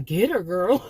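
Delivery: a crowd urging a friend to fight back; loud retold egging on; cheering on. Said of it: "they were saying".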